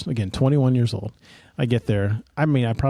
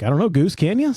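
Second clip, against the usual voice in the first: mock teasing; 6 semitones higher, 3 dB louder than usual. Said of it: "And I'm like".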